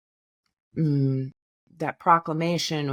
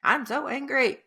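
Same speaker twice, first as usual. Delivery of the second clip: mock angry; 10 semitones higher than usual. Shown as a demonstration. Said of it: "now I'm getting angrier".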